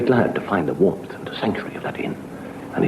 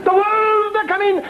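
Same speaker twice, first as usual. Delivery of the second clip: alarm cry; slower than usual. Acted. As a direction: wail the warning up high. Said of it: "the same cry".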